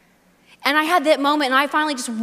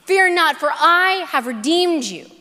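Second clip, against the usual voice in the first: ringing proclamation; 6 semitones higher, 4 dB louder, slower than usual. Shown as a demonstration. Quoting Isaiah, repeated with building emphasis.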